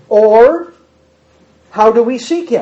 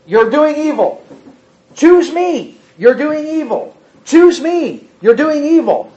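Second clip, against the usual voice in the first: raised-pitch insistent chant of God; voice of God pleading; 7 semitones higher than usual. Acted. God calling his people to choose him, insistent raised chant. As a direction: press it again and again, a divine plea. God's repeated call voiced.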